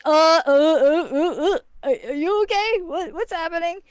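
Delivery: stammering, high-pitched mock panic; hammed-up flustered concern; flustered alarm. Retold friends panic at her crying.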